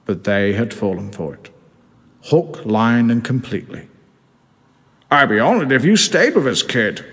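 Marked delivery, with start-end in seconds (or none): 5.1-7.0 higher, hearty sea captain voice; grand old skipper welcoming the kid, higher voice; Captain Birdseye. Acted. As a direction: play the cigar-puffing captain, pitched up. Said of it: "said Captain Birdseye".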